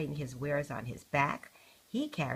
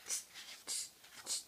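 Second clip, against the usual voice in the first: soft hissed tsk tsk tsk; mocking monkey tsk; 15 dB quieter than usual. A narrator's reading. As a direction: tut back like a cheeky monkey. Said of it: "and said".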